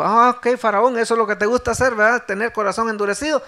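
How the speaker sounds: loud, high, quick sarcastic challenge; mock retort. God's retort to Pharaoh, acted.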